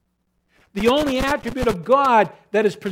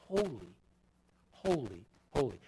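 much softer and lower, almost breathed; hushed reverent; 8 semitones lower, 14 dB quieter than usual. Acted. Preacher echoing angels' song to congregation, hushed awe. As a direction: drop to an awed hush.

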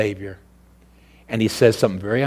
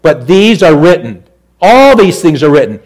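full-force preaching; 6 semitones higher, 12 dB louder than usual. Acted. Preacher thundering scripture to the congregation, full force. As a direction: preach it out to the back pews.

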